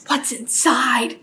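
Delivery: slow whispered question; breathy storybook whisper; hushed wonder. Acted. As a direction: whisper it slowly, full of suspense. Cat asks about cracking egg.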